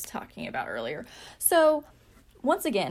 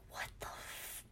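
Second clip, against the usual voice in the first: whisper; 20 dB quieter than usual. Retold or imitated. Shocked at missed calls and texts.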